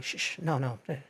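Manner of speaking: soft urgent shh, telling fiancée to be quiet; hushing. Retold or imitated. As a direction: quietly shush her to stay out of it. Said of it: "I'm like".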